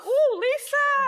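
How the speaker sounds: slow high teasing ooh; drawn out ooh. Real, not acted. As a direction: stretch it out, impressed. Reacting to harsh shade.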